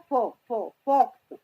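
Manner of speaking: "फो, फो" building into a loud "फॉक्स"; sounding out. Shown as a demonstration. Teacher sounding out fox, "फो, फो" then whole "फॉक्स". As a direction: repeat "फो", then land on "फॉक्स". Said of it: "क्या पढ़ रहे हैं एफ को".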